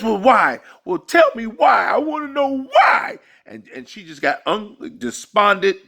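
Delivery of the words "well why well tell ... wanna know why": high, loud mock sobbing shout; woman pleading with her departing man, hammed-up crying shout; crying demand. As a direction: wail the question like a despairing woman.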